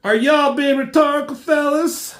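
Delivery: high, loud put-on drawling tease; a cocky stranger teasing the villains; mocking hero voice. Hero interrupting the Phalanx, high loud cocky taunt.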